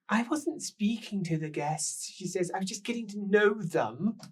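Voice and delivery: high, loud, slow and theatrical; shrill tipsy woman. Acted. Rouged woman on the stairs gushing at the guests, high and loud. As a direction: gush it like a tipsy lady of the house.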